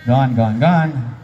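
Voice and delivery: normal talking voice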